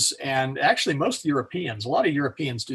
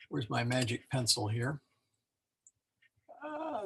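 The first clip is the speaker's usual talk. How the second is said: ordinary talk, nothing marked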